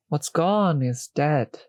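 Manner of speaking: ordinary delivery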